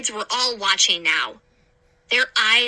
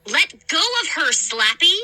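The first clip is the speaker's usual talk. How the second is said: demanding; 6 semitones higher than usual. A narrator's reading. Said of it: "I demanded".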